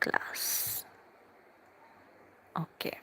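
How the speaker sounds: unvoiced aside under the breath; a breathy whispered aside. Real, not acted. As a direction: murmur it to yourself as you read. Whispered aside reading comment.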